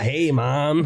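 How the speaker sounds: a laughing put-on American accent; mock American "Hey, man" with a laugh. Boy showing off to his mum in an American accent. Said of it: "talk American".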